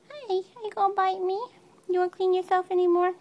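playful high coo at the kitten. Real, not acted. Owner teasing her nibbling kitten in baby talk. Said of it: "You make me talk baby talk".

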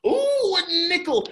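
high, loud thrilled gasp over a coin; delighted ooh. Acted. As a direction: squeal with joy at the nickel. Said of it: "Jack was like".

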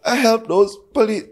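high, laughing through the words; laughing high-pitched retelling, close to crying; laughing half-crying. Retold or imitated. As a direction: tell it while cracking up near tears. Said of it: "sound like him a cry".

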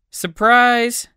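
high, drawn-out exclamation of surprise; surprise call. Shown as a demonstration. Language teacher demonstrating an exclamation for learners, high surprise call. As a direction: sing out the word brightly, pitched well up.

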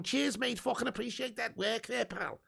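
cheeky Liverpool lad in a put-on Scouse; thanking a viewer for the praise in mock Scouse; Scouse accent. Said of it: "thank you for the good pronunciation there, says Scouse Don".

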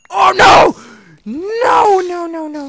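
high loud cries of no; panicked shout. Real, not acted. Player under attack, yelling no in panic. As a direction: yell in alarm.

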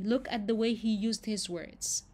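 usual speaking voice

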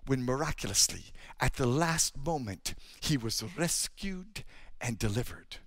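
low, soft, intimate preaching; hushed sermon storytelling. Acted. Preacher telling rescue stories to the congregation, soft and low. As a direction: draw the listeners in with a soft, low voice.

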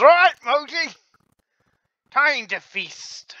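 high loud shout, then slow; rally the lion to feast. Acted. Beast tamer shouting to his lion to feast, high and loud. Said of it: "and says".

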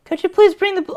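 very high, dainty little lady voice; high petite old lady voice reading dialogue. Pretend. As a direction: squeak it out like a tiny old lady. Petite voice resumed.

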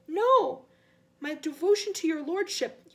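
high, loud frantic denial; frightened servant pleading devotion; panicked protest. Acted. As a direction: burst out in desperate protest. Servant protests, gets cut off.

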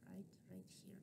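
soft unvoiced whisper under the breath; hushed aside while showing the bone. Real, not acted. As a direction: murmur it to yourself while pointing. Teacher pointing, aside to self.

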